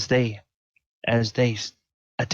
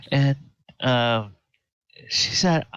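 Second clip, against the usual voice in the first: ordinary delivery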